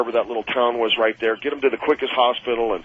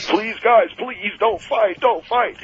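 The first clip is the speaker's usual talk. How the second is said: high, tearful plea; crying voice begging them not to fight; a grown man in tears begging; tearful pleading; 10 semitones higher, much slower than usual. Retold or imitated. He's crying.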